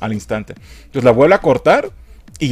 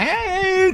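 grito muy agudo imitado; grito burlón. Retold or imitated. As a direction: imita el grito del loco, en burla. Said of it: "toda la película son gritos asquerosos". (grito agudo de burla)

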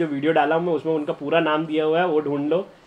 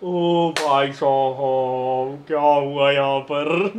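very slow, stretched disbelief; bewildered drawl; 2 semitones lower, much slower than usual. Real, not acted. Streamer staring at the carnage, slow stretched bewilderment. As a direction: drag it out, totally lost. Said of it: "मेरे को कुछ नहीं समझ आया".

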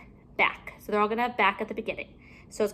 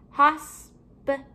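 HOSPITAL slowly split into three, a little higher; syllable by syllable; 4 semitones higher, slower than usual. Shown as a demonstration. Teacher breaking down a word for pupils, syllable by syllable. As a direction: leave gaps between the syllables of HOSPITAL.

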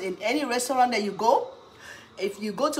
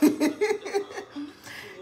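high laugh; 9 semitones higher, 4 dB quieter than usual. Real, not acted. Laughs at the end.

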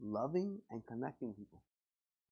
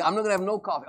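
grumbling churchgoer; 3 semitones higher, 6 dB louder, faster than usual. Acted. Pastor mimics a fasting member, a louder mock whine. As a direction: act the grumpy member denied coffee.

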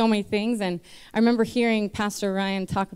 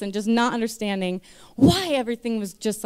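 normal talking voice

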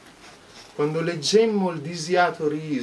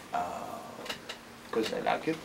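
ordinary talk, nothing marked

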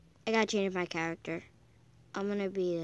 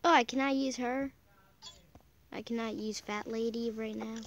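ordinary delivery

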